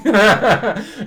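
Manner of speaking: loud high burst of laughter; real laugh. Real, not acted. Laughs at own joke.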